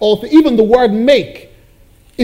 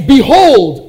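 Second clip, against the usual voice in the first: loud proclaimed behold; 6 semitones higher, 8 dB louder than usual. Shown as a demonstration. Preacher reads key word.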